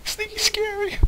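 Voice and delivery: squeaky voice. Pretend. Squeaky mock voice.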